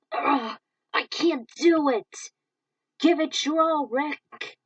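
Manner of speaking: slow loud anguished shout; pushing past the limit; straining cry. Acted. Rick straining at the core, crying out.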